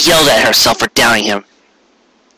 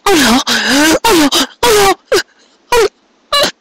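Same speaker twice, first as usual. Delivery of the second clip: rising, ever higher frantic cries; crying aloud in panic. A narrator's reading. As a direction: spiral up into hysteria. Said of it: "she cried aloud".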